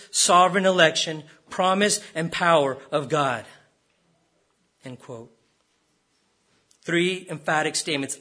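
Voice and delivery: normal talking voice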